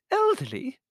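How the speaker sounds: very high squeaked disbelief; outraged squeak. A narrator's reading. Countess stung by servant's insult, squeaking. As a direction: squeak it in affront. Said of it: "she squeaked".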